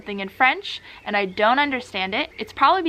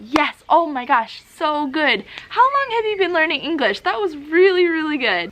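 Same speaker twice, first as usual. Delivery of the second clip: higher-pitched, delighted praise; encouraging cheerleader; 6 semitones higher, 4 dB louder than usual. Acted. Host cheers on a student learning English, gushing praise. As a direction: gush like the student's biggest cheerleader. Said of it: "their cheerleader".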